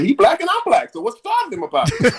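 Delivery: high put-on voice, indignant; retold indignant question. Bobby questioning the police, high indignant put-on voice. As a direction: ask it with mock disbelief. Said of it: "He said".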